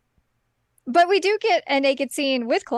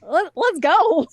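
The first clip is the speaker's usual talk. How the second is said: slow, higher-pitched rallying call; brash gung-ho hero; 5 semitones higher, 6 dB louder, slower than usual. Acted. Host voicing Jack ready for battle, gung-ho. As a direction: stretch it out like a hero charging in. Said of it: "He's just like".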